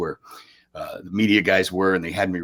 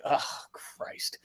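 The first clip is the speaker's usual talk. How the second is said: whispered groan; 6 semitones higher, 8 dB quieter than usual. Retold or imitated. Reacting to the recruiter's news.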